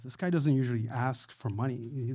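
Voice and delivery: usual speaking voice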